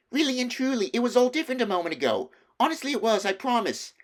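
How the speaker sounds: put-on a child desperate to be believed; pleading young Lucy. A narrator's reading.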